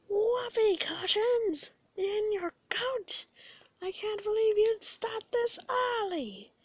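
high, slow indignant skeleton squawk; Papyrus outrage. Acted. Papyrus catches Sans's whoopee cushion prank, indignant squawk. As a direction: act out Papyrus, scandalized by the prank.

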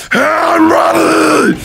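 long, high, loud scream; screamed battle cry. Real, not acted. Player psyching up against Freddy, screaming. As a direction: stretch it into a full-out yell.

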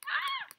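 very high-pitched shriek; startled scream. Real, not acted. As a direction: scream in fright at a jump scare. Startled during scary game.

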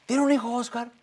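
put-on high-pitched child's question; young son. Son questions his father after the fight, high child voice. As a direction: ask dad like a little kid.

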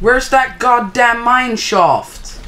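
a slow, loud, high demand; frustrated yell. Real, not acted. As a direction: vent at the missing shaft. Frustrated searching in the game.

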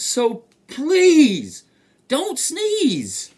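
high, loud, drawn-out plea; exaggerated storybook begging; urgent begging. A narrator's reading. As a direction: wail the plea at the elephant. Animals beg elephant not to sneeze.

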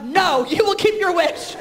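slow, high, insistent mock command; hammed-up bossy mom insisting; mock psycho mother. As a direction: lay down the law, half joking. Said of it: "psycho mother".

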